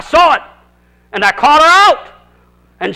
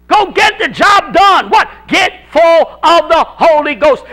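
higher and louder, shouting the point home; preaching at full force; 5 semitones higher, 4 dB louder than usual. Acted. Pastor rousing the congregation, fiery shouted preaching. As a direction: build to a preacher's shouted climax.

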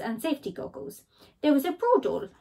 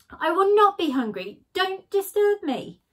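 higher, louder, then slow; firm Badger; 8 semitones higher, 5 dB louder than usual. Badger shutting out Skunk, firm and slow. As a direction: dismiss firmly.

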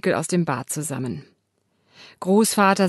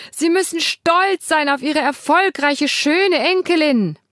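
put-on high, loud call to a deaf old man; shouting; 9 semitones higher, 5 dB louder than usual. Carer Simone calls the grandfather, shouting high. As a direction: shout up at him, brightly. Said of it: "schreit sie".

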